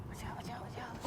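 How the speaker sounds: whisper. Real, not acted. Bubbles coming up, warning quietly.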